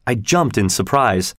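usual speaking voice

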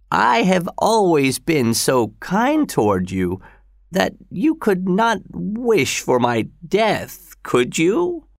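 put-on high, slow dying old man; old father voice. A narrator's reading. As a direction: voice the frail father, rising.

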